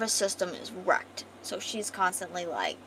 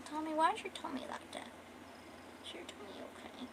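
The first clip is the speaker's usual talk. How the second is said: cooing concern; 8 semitones higher, 14 dB quieter, faster than usual. Real, not acted. Narrator checks on Tommy's tummy, cooing softly. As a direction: fuss gently in a small high voice.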